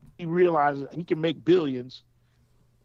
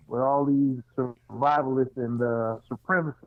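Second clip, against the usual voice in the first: muffled, like through a pillow; muffled phone line. Real, not acted. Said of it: "are you talking through a pillow or something?".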